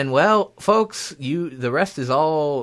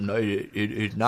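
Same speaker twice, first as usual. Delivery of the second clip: flustered, stammering simpleton; Forrest Gump; 3 semitones lower than usual. Acted. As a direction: trip over the words in dim-witted protest. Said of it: "And then Forrest Gump".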